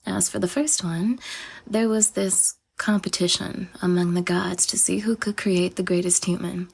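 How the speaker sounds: ordinary delivery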